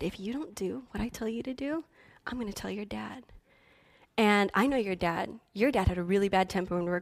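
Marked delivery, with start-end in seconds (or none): 0.0-1.8 menacing whisper. Retold or imitated. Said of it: "and she said". 2.3-3.2 hushed, voiceless warning; whispered threat. Retold or imitated. Relative threatening the frightened child, in a whisper.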